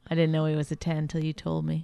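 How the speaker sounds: ordinary talk, nothing marked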